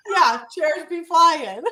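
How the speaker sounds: slow words wrapped in high laughter; laughing. Real, not acted. Agreeing with the host's joke, laughing.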